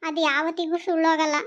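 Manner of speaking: ordinary delivery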